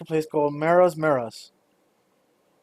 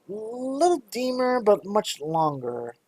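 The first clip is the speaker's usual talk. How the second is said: lightly voiced shopkeeper; 7 semitones higher, 3 dB quieter, slower than usual.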